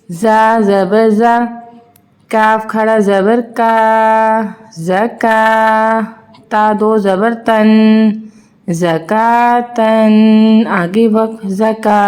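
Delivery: put-on drawn-out sing-song spelling drill for children; teacher slowly spelling out Arabic syllables for young pupils; slow letter-by-letter recitation. Shown as a demonstration. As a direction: chant each letter and vowel slowly, as in a Qaida lesson.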